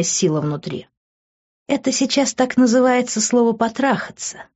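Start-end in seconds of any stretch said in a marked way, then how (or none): 1.7-4.5 higher, mocking female voice; scoffing. A narrator's reading. Girl doubts his declaration of love, scoffing. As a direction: sneer lightly, pitched up. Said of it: "усмехнулась она".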